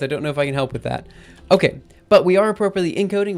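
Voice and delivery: ordinary delivery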